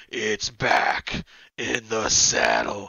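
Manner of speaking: low, very slow, drawn-out villain growl; deep Freddy voice. Retold or imitated. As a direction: drag it out like a gloating dream demon. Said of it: "He goes".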